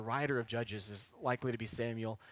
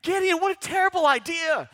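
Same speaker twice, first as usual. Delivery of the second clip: mock scoffing listener; 11 dB louder than usual.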